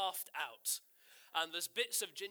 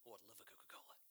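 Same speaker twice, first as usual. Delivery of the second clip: whispered reply; 8 semitones lower, 17 dB quieter, much faster than usual. Retold or imitated. Girl eagerly accepting a drink.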